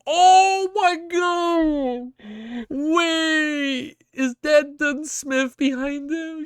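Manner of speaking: high, long-drawn wail of disbelief; tearful shock. Real, not acted. Streamer spotting Dunsmith behind him, tearful shock.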